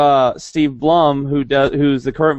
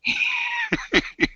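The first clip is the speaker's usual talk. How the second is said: high laugh; 8 dB quieter than usual. Real, not acted. Laughing after impression.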